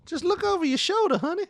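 squeaky menacing taunt, high pitched; high mock threat.

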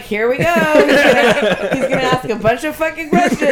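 laughing loudly through the words; exasperated, cracking up; laughing exasperation. Retold or imitated. Mock grumble about questioner.

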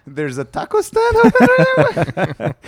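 very high, loud disbelieving question; mock surprise. Joking about the stadium with friends, in high mock surprise. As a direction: squeal it in fake disbelief. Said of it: "S0 asks what".

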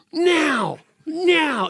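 high, loud showy "Now, now"; mock TV evangelist. Preacher mocks a televangelist's showy command. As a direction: ham it up like a TV preacher. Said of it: "like a T V evangelist".

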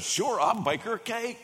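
high, eager agreement; excited chef agreeing. Retold or imitated. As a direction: jump at the idea happily. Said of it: "he got excited".

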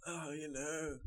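breezy Irish lilt; Irish accent. Pretend. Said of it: "brilliant Irish accent".

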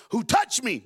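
calling out who touched me; sudden loud question; loud Jesus quote. Acted. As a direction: raise it as Jesus asking. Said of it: "Jesus said".

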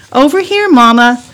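put-on high child's answering call; calling back. Girl at the creek answering mama, calling. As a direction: call back to mom from a distance.

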